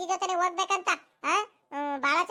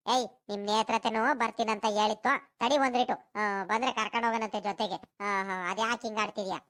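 much lower voice, calm scolding; a deeper neighbour settling the boy; lower-voiced woman, mildly chiding; low village woman; 6 semitones lower than usual. Low-voiced character answering.

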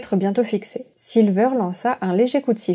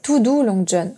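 ordinary delivery

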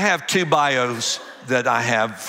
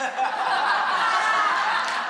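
a long high cackle at his own joke; real high laugh at the absurd moment; high burst of laughter; 3 dB quieter than usual. Real, not acted. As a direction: break into high, helpless laughter.

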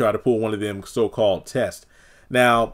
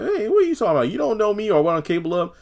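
much higher, sassy retort; mock offended boast; 10 semitones higher, faster than usual. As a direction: scoff at him high and sassy. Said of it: "It's like".

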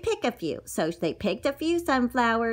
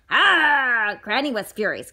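ordinary delivery, nothing marked

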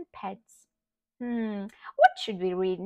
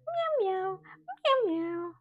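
high-pitched imitated meows; cat meowing; 11 semitones higher than usual. Shown as a demonstration. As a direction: mew like a little cat. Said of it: "What does a cat say?".

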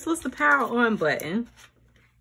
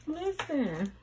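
ordinary delivery

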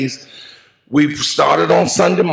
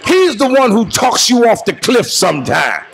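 full preaching; 5 semitones higher than usual. Acted. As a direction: preach it out at full force to the mourners. Eulogy preached to the congregation.

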